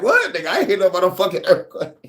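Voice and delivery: high, fast mock disbelief, laughing; surprised what. Shocked friend scoffing, high and fast. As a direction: friend on the phone, incredulous he missed it.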